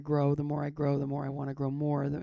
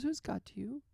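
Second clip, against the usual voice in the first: lightly imitated nurse, pitched higher; odd nurse; 6 semitones higher, 3 dB quieter than usual. Nurse questions patient about God, lightly imitated in higher voice. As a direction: ask it as a gently odd nurse. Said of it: "she had a weird energy to her".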